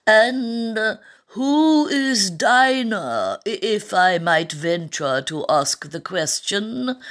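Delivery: high, very slow, halting question; hesitant lorry. A narrator's reading. The lorry asking Alice about Dinah, hesitant. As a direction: draw it out with timid politeness.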